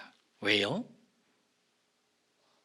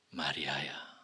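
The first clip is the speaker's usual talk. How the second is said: tender call; 11 semitones lower, 11 dB quieter, slower than usual. Acted. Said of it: "이름을 부르십니다".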